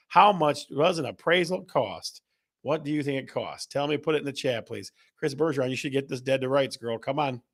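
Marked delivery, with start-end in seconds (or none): none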